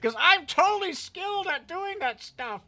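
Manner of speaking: high, loud sarcastic brag. Pretend. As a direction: oversell it with mock pride. Sarcastic self-mockery.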